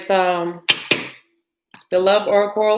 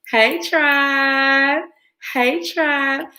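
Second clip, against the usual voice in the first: higher, lilting call with a rising end; playful higher hello to her viewers; sing-song greeting; 6 semitones higher, much slower than usual. Real, not acted. Repeats greeting sing-song to audience.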